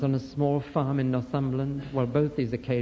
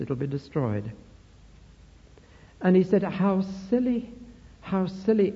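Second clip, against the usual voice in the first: higher, louder, rising fervour as the vision unfolds; impassioned pulpit retelling, louder with each field; preaching at full force; 4 semitones higher, 3 dB louder than usual. Acted. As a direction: preach it, climbing toward the climax. Preacher builds vision to climax.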